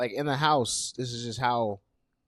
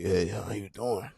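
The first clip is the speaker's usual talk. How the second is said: lower, slower, deeper take on a greeting; 4 semitones lower, 5 dB quieter, slower than usual. Shown as a demonstration. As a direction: drop the voice low and take it slow. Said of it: "my voice would be a little deeper".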